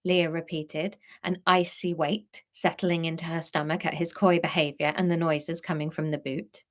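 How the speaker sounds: usual speaking voice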